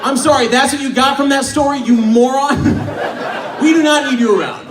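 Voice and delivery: hammed-up outrage; exasperated rant. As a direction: tell off a clueless listener. Comic rant at girl's response.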